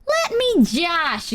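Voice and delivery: high, drawn-out mock plea; playful whine. Streamer defending her teasing of a friend, playfully whining. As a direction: stretch it into a sassy high whine.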